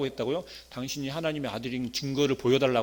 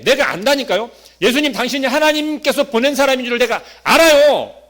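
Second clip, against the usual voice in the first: louder, higher insistent claim; insistent Nicodemus; 7 semitones higher, 10 dB louder than usual. Acted. Nicodemus insisting to Jesus, loud and higher. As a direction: press the point like a man sure he knows.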